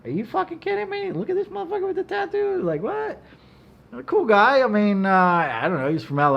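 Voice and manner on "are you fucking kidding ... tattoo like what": much higher, astonished squeal of delight; amazed disbelief. Retold or imitated. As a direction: sound blown away by what you see. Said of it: "And I'm like".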